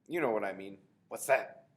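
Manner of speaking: loud, sudden alarm; startled kid in the dark. A narrator's reading. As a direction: snap to the noise.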